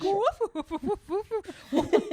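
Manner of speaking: high little classy dog bark; a refined dog yapping politely; dainty barking. Retold or imitated. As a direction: lap dog barking primly. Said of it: "She was like".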